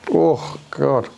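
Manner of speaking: ordinary delivery, nothing marked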